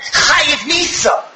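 King David handing down a death sentence; king's verdict. Acted. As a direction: pronounce the sentence slowly. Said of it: "And David HaMelech said".

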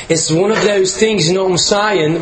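hammed-up British accent that misses; bad British accent. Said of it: "That was, that was terrible".

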